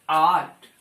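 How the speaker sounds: आर्ट said with a long open aa, low and firm; long aa sound. Shown as a demonstration. Teacher shows the aa sound in आर्ट, low and firm. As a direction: hold the aa in आर्ट. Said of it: "आ का यहाँ पे sound है".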